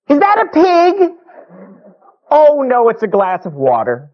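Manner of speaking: high, loud squinting-guy voice; loud, exaggerated near-sighted man; guy with bad eyesight. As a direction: call it out slowly, then realize the mistake. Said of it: "a guy with really bad eyesight".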